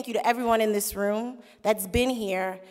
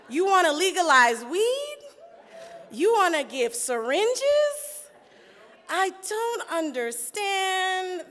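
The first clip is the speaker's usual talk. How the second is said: slow, higher-pitched incredulous questions; mock bewilderment; 6 semitones higher, slower than usual. Young intern who thought everyone was crazy, mock bewilderment. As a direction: stretch each question in hammed-up disbelief. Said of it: "I thought y'all were crazy".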